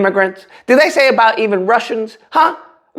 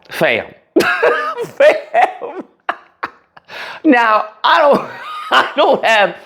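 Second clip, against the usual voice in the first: laughing; 7 semitones higher, much slower than usual. Real, not acted. Laughing at the excuse.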